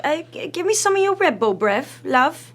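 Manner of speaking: high, loud imitation of a British man; British accent. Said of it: "in his adorable accent".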